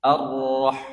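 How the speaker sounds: 'Ar-Rah' with the vowel coming out O; thick ra. Shown as a demonstration. Teacher showing the heavy letter, O-coloured ra. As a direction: say 'Ar-Rah' as 'ar-roh', rolled r. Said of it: "Huruf vokalnya yang keluar O".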